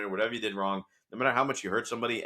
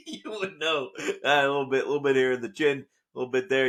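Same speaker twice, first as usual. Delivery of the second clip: high, louder words still laughing; self-mocking laughter about the chin; laughing through words; 7 semitones higher, 7 dB louder than usual. Real, not acted. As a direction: talk while giggling at yourself. Joking about his own weight.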